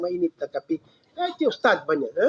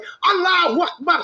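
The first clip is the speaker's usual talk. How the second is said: much higher, slowed cry of joy; joyful exclamation; 10 semitones higher than usual. Retold or imitated. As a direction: burst out in thankful delight. Joyful exclamation after conversion.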